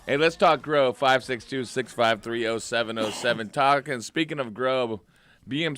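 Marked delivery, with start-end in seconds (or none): none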